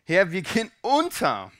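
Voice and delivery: loud, urgent cry for help. A narrator's reading. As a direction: call out as if the boat is sinking. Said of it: "schrien sie".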